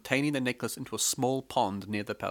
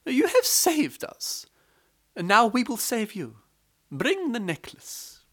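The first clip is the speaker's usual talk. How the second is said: clever wife; 11 semitones higher than usual. A narrator's reading. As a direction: promise to save her, calmly. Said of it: "She said".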